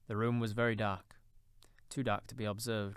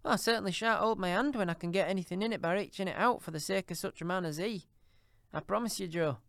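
coolly; 9 semitones higher, 6 dB louder than usual. A narrator's reading. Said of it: "returned the woman coolly".

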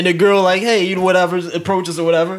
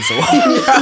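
high, slow, laughing; 4 dB louder than usual. Real, not acted. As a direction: crack up mid-word. Reading funny comment.

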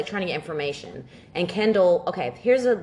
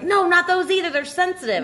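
high, louder mock protest; mock squeal; 12 semitones higher, 4 dB louder than usual. Friend touched on the shins, high squealing protest.

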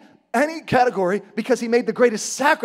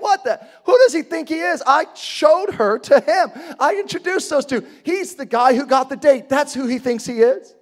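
high, loud mock outrage; sputtering, worked-up indignation, pitched high; indignant; 5 semitones higher, 4 dB louder than usual. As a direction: rant like a jilted friend. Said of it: "that's when you got indignant. You're like".

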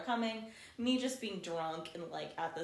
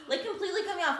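indignant; 12 semitones higher, 5 dB louder, much faster than usual. Retold or imitated. Indignant retelling of being cut off.

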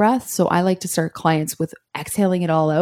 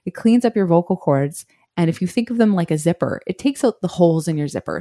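ordinary delivery

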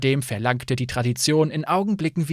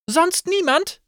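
much higher call to the room; 3 dB louder, slower than usual. Acted. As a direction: ask everyone at once. Innkeeper calls question.

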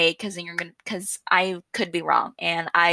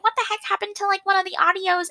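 confused listener; 12 semitones higher, faster than usual. Retold or imitated. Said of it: "you're like".

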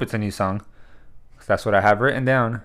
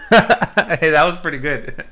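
laughing; 8 semitones higher, 3 dB louder, slower than usual. Real, not acted. Laughing at friend's impression.

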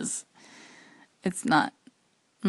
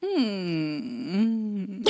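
ordinary talk, nothing marked

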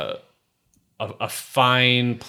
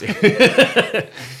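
high burst of laughter; 7 dB louder than usual. Real, not acted. GM cracks up.

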